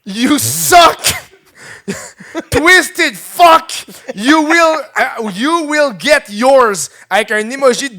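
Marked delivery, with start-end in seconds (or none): 0.0-1.0 high, loud hammed-up snarl; mock rage. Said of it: "Elle a tweeté". 2.5-6.9 high, loud, slow hammed-up threat; mock threat. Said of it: "Elle a tweeté".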